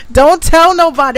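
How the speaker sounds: high, loud teasing don't tell nobody; playful exclamation. Real, not acted. Joking secret.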